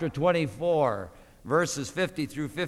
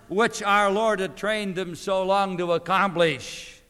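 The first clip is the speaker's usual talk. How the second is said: higher and louder, building intensity; rising preaching; 5 semitones higher, 7 dB louder than usual. Acted. Preacher building to his congregation, full force. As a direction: build it toward the climax.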